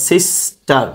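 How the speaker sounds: Sister slowly broken as সিস, টার; split into syllables. Shown as a demonstration. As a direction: hold সিস, pause, then say টার in sister. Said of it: "দুইবার ভাগ করা গেছে". (Sister দুই ভাগে ভেঙে উচ্চারণ)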